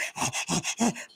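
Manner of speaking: happy dog pant, mimicked; imitated dog panting. Dog in heaven with family, imitated happy panting. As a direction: pant in quick bursts like a delighted dog. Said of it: "he's just like".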